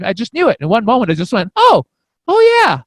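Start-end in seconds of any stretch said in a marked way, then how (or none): 1.5-2.8 very high, loud retold realization; eureka exclamation. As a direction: exclaim as it suddenly clicks. Retells the moment of knowing.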